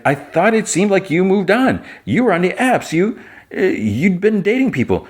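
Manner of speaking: high-pitched acted self-defense; defensive protest. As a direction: play a flustered friend pleading his case. Jimmy defends himself in quarrel.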